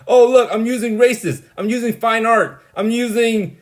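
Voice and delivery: loud, high mocking voice imitating a pompous friend; mocking Min. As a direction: ham up a smug show-off who loves his big words. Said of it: "That's what Min looks like".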